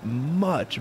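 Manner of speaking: usual speaking voice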